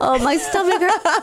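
breathless from laughing; words squeezed out mid-laugh; after laughing hard. Real, not acted. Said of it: "Every time I laugh".

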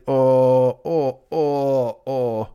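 fake sick moaning. Sick at home with Karen, mock groaning. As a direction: whimper it out for sympathy. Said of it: "I would get sick and I'd be like".

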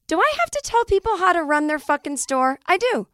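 exasperated. Acted. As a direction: rant it high and fed up. Exasperated rant.

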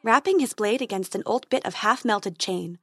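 ordinary delivery, nothing marked

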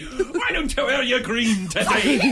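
high, far-off put-on Irish girl voice; mock Irish woman. Host pushed by co-hosts to do an Irish accent, high and giggling. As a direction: laugh through a girly Irish accent. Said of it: "like an Irish chick".

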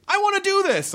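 high eager fan voice; eager quote. Retold or imitated. Fan backstage begging to join the show, high and eager. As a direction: squeak it out like a starstruck fan. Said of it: "you were like".

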